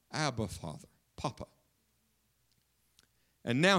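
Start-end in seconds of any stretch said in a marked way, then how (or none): 0.1-1.5 whispered-soft and low, intimate; tender cry to father. Retold or imitated. Jesus in the garden calling on his Father, soft and tender. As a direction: call out gently, as a child to his dad. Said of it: "he cried".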